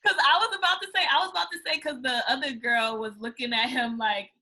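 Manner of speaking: ordinary delivery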